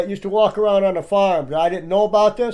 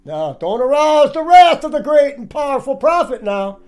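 raised, mock-grand warning; higher, taunting proclamation; mocking parody; 6 semitones higher than usual. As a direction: parody the great wizard's warning. Mockingly parodies the Wizard clip.

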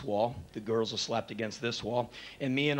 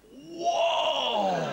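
loud, high, amazed whoa with a laugh; crowd whoa; 11 semitones higher, 3 dB louder than usual. Retold or imitated. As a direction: shout it like a stunned crowd. Crowd reacts to the catch.

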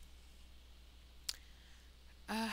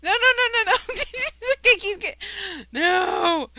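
high loud squealing panic; panicked squeal; 10 semitones higher, 15 dB louder than usual. Real, not acted. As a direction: shriek it in panic. Mob attacks, panic.

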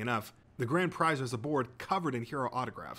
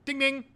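high-pitched, loud voiced buzzer ding; 6 dB louder than usual. A narrator's reading. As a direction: chime a sudden high ding-ding. Narrator imitates the quiz buzzer.

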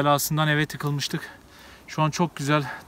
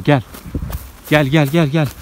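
calling out; 5 dB louder than usual. Real, not acted. Calling cameraman while running.

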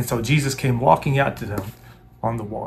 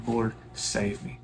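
soft slow plea; 9 dB quieter, slower than usual. Shown as a demonstration. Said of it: "when I cry out to God".

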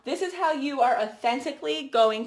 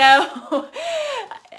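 high, loud burst of laughter; 14 semitones higher, 7 dB louder than usual. Real, not acted. Laughing at her own impression.

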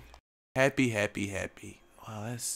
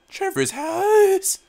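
voice crack; 8 dB louder, slower than usual. Real, not acted. Player asking about Trevor's house, voice cracking. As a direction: voice cracking high and slow. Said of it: "That voice crack".

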